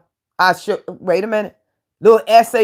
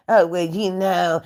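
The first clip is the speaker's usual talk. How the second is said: slow, soft, limp mock voice; mocking an excuse; 2 semitones lower, 4 dB quieter, slower than usual. The host mocking a young man's lame excuse, slow and limp. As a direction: drag it out like a weak excuse.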